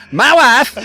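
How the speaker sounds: loud, high mock Kazakh accent; Borat. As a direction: belt out the catchphrase in a comic fake Kazakh accent. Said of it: "who really hates my Borat impression".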